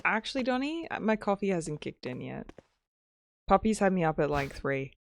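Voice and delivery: usual speaking voice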